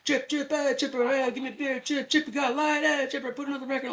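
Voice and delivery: high, slurred party voices calling Chip; drunk partygoers calling. Retold or imitated. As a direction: holler across the room like tipsy guests.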